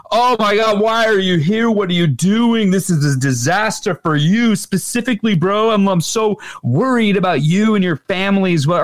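retelling the lawyers' fake worry to co-hosts, in a high, hammed-up panic; frantic mock lawyer. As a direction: play the panicking lawyer who is faking concern. Lawyers confronting him, retold.

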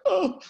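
high loud crying wail "Oh"; an imitated burst of tears; mock sob. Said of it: "I was like".

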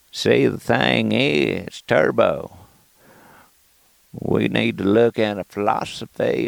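slow, laid-back drawl with long pauses; Matthew McConaughey. Acted. Comic musing on philosophy of life, laid-back McConaughey impression. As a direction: take it easy like a philosophizing McConaughey. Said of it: "so laid back".

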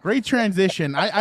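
usual speaking voice

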